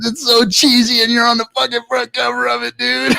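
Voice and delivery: high retold buddy exclamation; excited report. Buddy reporting the cheesy front cover, excited. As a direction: imitate an amazed buddy, pitch well up.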